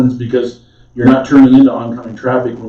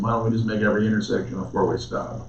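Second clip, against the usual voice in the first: sarcastic; 10 dB quieter than usual. Real, not acted. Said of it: "here comes my sarcasm".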